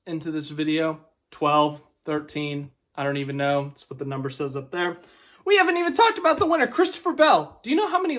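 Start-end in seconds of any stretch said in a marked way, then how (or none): 5.4-8.2 much higher, fast, bursting out; suddenly remembering the winner and rushing to say it; excited outburst. Real, not acted. Vlogger catching himself forgetting the winner, excited burst.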